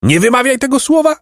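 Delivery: very high, fast outcry; sharp outcry. A narrator's reading. Great lord forbidding the word gold, sharp outcry. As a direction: cut him off, high and fast. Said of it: "zakrzyknął wielki pan".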